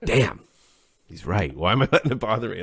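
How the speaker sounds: high, loud, quick dawning surprise; sudden realization. Retold or imitated. Realizing the coworker is right, loud high surprise. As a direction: blurt it like it just clicked. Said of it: "And I'm like".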